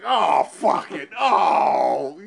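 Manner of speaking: loud, slow disgusted outburst; mock disgusted shout. Nurses shouting in disgust at filthy patient. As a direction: imitate nurses recoiling in disgust. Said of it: "And they're like".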